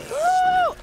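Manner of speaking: very high, loud "woo"; real startled squeal mid-game; high-pitched whoop. Real, not acted. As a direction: let out a sudden high whoop. Startled in the middle of play.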